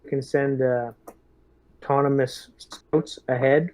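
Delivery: normal talking voice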